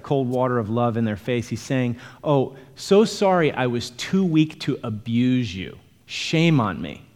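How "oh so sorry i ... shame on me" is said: slow, exaggerated mock apology; sarcastic. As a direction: pretend to be sorry, dragging it out sarcastically. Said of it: "he brings some more sanctified sarcasm".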